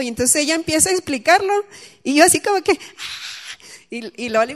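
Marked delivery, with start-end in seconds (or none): 2.7-3.3 breathy, voiceless gasp of wonder; amazed gasp. Retold or imitated. Speaker reacting to Jamie's explanation, amazed gasp. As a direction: gasp as if astonished.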